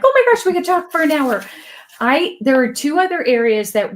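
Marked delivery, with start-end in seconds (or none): 0.0-1.4 quick, high burst of delight; excited exclamation. Retold or imitated. Host realizing time is short, excited. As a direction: blurt it out, thrilled. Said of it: "I'm like".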